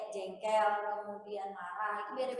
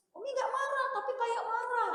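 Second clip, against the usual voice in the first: high, louder imitation of a child; child's voice; 13 semitones higher, 9 dB louder than usual. Child telling mother she sounds angry, high and loud. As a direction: pipe it up like a child correcting mom.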